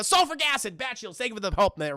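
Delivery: normal talking voice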